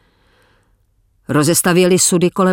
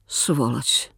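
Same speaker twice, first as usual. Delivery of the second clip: bitter muttered curse; 5 semitones lower, 7 dB quieter than usual. Acted.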